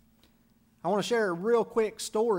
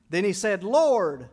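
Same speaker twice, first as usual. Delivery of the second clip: loud preaching; 8 dB louder, slower than usual. Acted.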